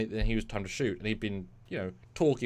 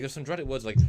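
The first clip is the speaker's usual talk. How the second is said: usual speaking voice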